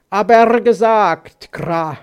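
loud, slow raven retort; croaking raven. Acted. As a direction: answer back with a caw. (krächzender, lauter Rabe)

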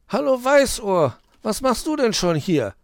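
high, loud, annoyed greeting; peevish. A narrator's reading. Tired fox greets early Weißohr, peevish. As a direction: greet an unwelcome early visitor crossly. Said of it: "fragte er verdrießlich".

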